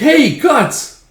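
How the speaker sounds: high, loud hail; acted, high-pitched call. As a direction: shout for a friend in a squeaky elf voice. Puck calls out to Guts.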